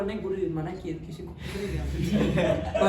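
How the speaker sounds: lightly mocking the student who won't speak up; mock obedient student. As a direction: play the over-literal disciple with a chuckle. Student obeying guru mocked.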